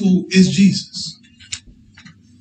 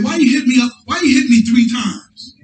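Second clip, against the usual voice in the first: higher, raised donkey voice pleading; donkey's protest; 4 semitones higher than usual. Retold or imitated. Preacher voicing the donkey asking Balaam why, higher and raised.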